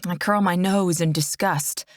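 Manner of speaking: ordinary delivery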